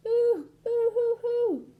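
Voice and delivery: put-on high, loud baby wail; baby crying sound. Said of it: "the baby crying again".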